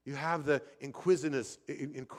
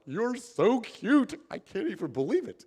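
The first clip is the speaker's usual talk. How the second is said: cooing at the bird; 8 semitones higher, 5 dB louder than usual. Retold or imitated. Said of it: "I'm like".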